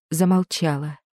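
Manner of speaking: ordinary delivery, nothing marked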